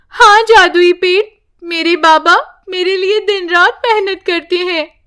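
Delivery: put-on a girl telling the tree about her father; young girl voice. Priya speaks of father. (ऊँची, भोली बच्ची की आवाज़)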